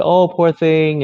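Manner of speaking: put-on mother pitying her fallen child, slow and high; cooing mom. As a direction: coo it like a mother soothing a child. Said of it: "my mom would, you know, would say".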